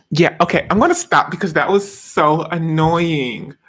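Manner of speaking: usual speaking voice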